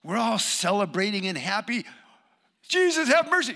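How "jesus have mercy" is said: loud, high plea; high, loud shout to get noticed; crying out. Acted. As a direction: call out excitedly for mercy. Acting the blind men calling out.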